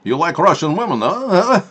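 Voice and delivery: high, loud hammed-up Russian teasing; mock Russian accent. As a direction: needle the viewer as a cartoon Russian.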